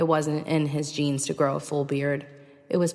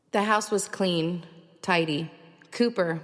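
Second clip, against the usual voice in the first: ordinary talk, nothing marked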